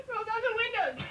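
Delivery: high, loud, cracking up mid-line; can't get through it for laughing; laughing scream. Real, not acted. Rereading the fart story, real laughing scream. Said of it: "As I screamed".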